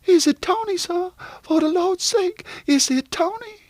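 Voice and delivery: very high, pleading servant woman; trembling hope. A narrator's reading. Wife asks if her husband has returned, high anxious pleading. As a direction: plead in a high voice, desperate to know. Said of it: "then her hands began to tremble".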